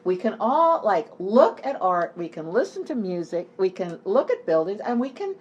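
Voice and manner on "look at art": usual speaking voice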